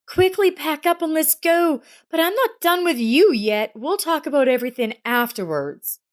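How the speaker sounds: urgent, mock-stern wife; brisk wife. A narrator's reading. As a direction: hurry him out the door, half teasing. Elsa urging husband.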